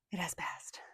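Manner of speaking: whispered, settling herself; relieved whisper. Real, not acted. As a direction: breathe it out in a whisper. Composing herself after tearing up.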